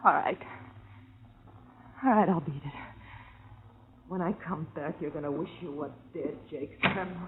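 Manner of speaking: quiet menace. Acted. Girlfriend quietly threatening Jake on her way out. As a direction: promise revenge under your breath.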